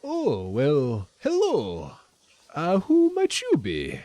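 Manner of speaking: put-on high, lilting accented greeting; shopkeeper Lenine. Shopkeeper greets newcomers walking in, lilting accented welcome. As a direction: greet strangers as the shopkeeper, pitch swooping up. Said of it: "she says".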